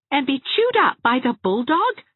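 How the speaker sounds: high, loud sarcastic question. A narrator's reading. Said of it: "said Anne sarcastically".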